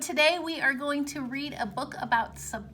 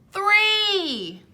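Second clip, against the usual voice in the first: a bright, louder, higher announcement; excited answer; 6 semitones higher, 6 dB louder than usual. Acted. Teacher reveals the count to the kids, excited. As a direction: cheer the answer out.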